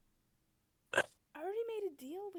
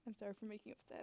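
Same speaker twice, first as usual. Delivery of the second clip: soft apology; 6 semitones lower, 12 dB quieter, much faster than usual. Acted. Placating apology to upset partner.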